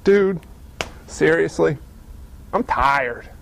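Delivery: low, sagging, tired complaint; weary reluctance. Acted. Healed believer waking up unwilling to praise, low weary grumble. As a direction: let the pitch sink wearily.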